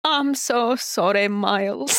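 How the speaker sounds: put-on slow, silly Scottish accent; Peter Quint. Co-host quotes the show to Miles, silly Scottish accent. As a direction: draw out a put-on Scottish apology. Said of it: "we already got Peter Quint".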